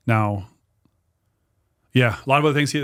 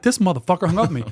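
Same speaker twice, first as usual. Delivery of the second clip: higher-pitched, quick indignant retort; indignant disbelief; 7 semitones higher, 3 dB louder, faster than usual. Retold or imitated. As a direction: blurt it out, affronted at being hung up on. Retelling his reaction to being hung up on.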